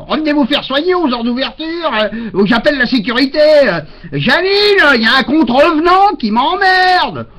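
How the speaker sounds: high, sharp office clerk calling her colleague; shrill jobsworth voice rising to a call; annoyed clerk. Retold or imitated. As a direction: play the fed-up clerk who wants him gone. Irritated clerk calls colleague.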